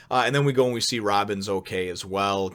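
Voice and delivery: ordinary talk, nothing marked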